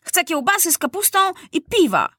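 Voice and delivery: put-on high, imperious boy king; high-pitched bossy outburst; bellowed demand. A narrator's reading. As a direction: demand it like a rough soldier. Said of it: "huknął Maciuś".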